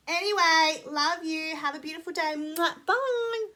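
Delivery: high, loud sing-song farewell; cheery goodbye. Real, not acted. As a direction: blow a kiss to the viewers and wave off. Sign-off goodbye.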